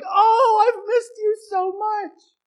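put-on high, loud, tearful cry of a little girl; slow, crying high voice; tearful joy. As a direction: sob out relief at seeing the cat again. Girl greets returning cat.

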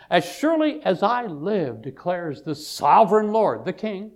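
high, emphatic pulpit reading; scripture proclamation. Acted.

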